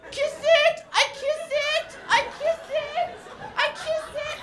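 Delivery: put-on high, loud toddler begging; pleading toddler. Son chasing his friend, high pleading toddler voice. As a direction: chase him with a high pleading kiss it. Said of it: "He's like".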